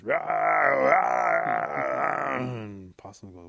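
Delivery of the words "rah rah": loud, drawn-out snarling roar; imitated brawl roar. Noise from inside the big council house, imitated roaring. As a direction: roar like a whole brawling crowd. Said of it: "all you hear is this".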